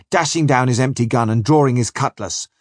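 ordinary delivery, nothing marked

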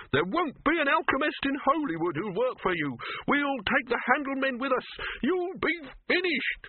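high-pitched, outraged threats; indignant threat. A narrator's reading. Silverfish warns Dibbler nobody will work for him, outraged. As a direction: threaten in a high squawk, dragging the last words.

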